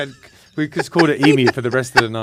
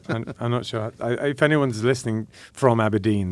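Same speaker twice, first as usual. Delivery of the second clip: ordinary talk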